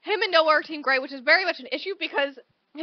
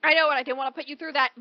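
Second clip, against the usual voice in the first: rushed quote; much faster than usual. Retold or imitated. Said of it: "And he's all like".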